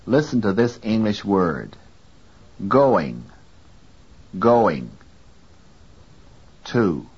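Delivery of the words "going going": GOING with GO louder, ing weaker; stress on first syllable. Shown as a demonstration. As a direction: say GOING with the weight on GO. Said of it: "first syllable go is louder".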